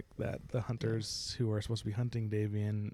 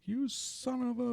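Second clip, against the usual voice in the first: high, slow angry outburst; mock furious cry; 13 semitones higher, slower than usual. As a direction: cry it out as he lunges. Losing hunter attacks.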